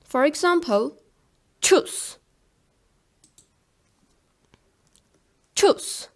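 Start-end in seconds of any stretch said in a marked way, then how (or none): 1.6-6.1 "choose" as German Tschüs, short vowel, final s. Shown as a demonstration. Teacher giving an example for learners, "choose" as German Tschüs. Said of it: "is pronounced like".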